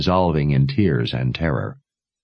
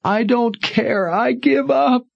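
high, slow despairing cry; high tearful surrender; despairing sob. Acted. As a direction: give up through tears. Daughter in despair.

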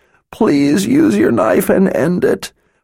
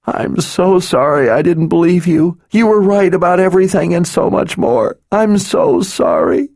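weeping; 12 semitones higher, 3 dB louder than usual. A narrator's reading. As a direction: pour it out through tears. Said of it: "She wept in utter misery".

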